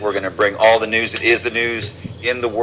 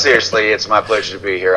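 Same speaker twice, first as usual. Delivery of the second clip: ordinary talk, nothing marked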